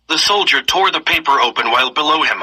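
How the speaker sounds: usual speaking voice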